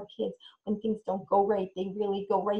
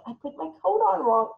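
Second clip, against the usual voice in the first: mock embarrassed; 7 semitones higher, 4 dB louder than usual. Shown as a demonstration. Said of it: "You can model it".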